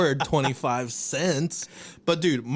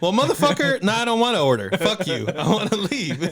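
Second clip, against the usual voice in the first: mock angry reply; 5 semitones higher than usual. Customer at drive-through box snapping at silent staff, mock angry. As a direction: play it hammed-up annoyed. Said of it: "It's like".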